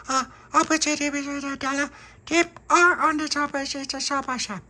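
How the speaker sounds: put-on very high garbled baby talk; tiny child's mumbled gibberish; baby babble. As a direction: babble like a toddler.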